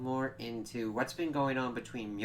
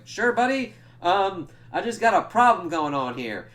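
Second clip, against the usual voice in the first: busy Cap retold; 7 semitones higher, 9 dB louder than usual. Acting out Cap replying mid-battle.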